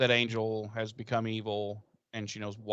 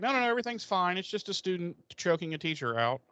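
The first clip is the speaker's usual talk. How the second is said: high, falsely calm Giles voice; mock Giles; 6 semitones higher, faster than usual. Giles reassuring students during choking, high fake calm. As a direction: pretend all is fine, pitched up. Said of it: "And he's like".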